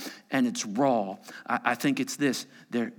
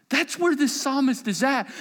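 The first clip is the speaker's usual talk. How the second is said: normal talking voice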